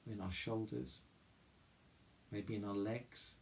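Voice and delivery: soft, calm meditation voice with long pauses; guided meditation. Real, not acted. Chapel leader guiding listeners through mindful breathing, guided meditation. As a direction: guide them gently inward, leaving room to breathe.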